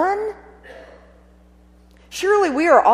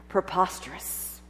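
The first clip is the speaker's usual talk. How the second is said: breathy scoffing laugh; 4 semitones lower, 11 dB quieter than usual. Acted. Scoffing at the idea.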